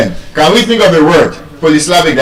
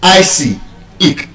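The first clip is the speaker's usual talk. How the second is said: normal talking voice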